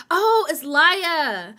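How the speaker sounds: loud, slow, drawn-out oh of realization; sudden realization. Real, not acted. Realizing she's been saying a viewer's name wrong, surprised. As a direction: catch your own mistake out loud.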